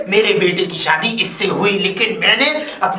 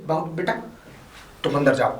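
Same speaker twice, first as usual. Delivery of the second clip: soft, lowered, kindly voice; gentle instruction; 6 semitones lower, 8 dB quieter, faster than usual. Acted. Father-in-law sending the favoured woman inside, gentle. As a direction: drop to a tender hush.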